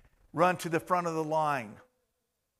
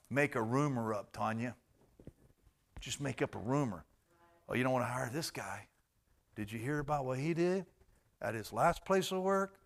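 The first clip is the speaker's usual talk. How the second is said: much softer, lower rumor-spreading; sly gossip; 5 semitones lower, 9 dB quieter than usual. Retold or imitated. Preacher acting out office gossip, hushed and sly. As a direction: lean in and spread the rumor quietly.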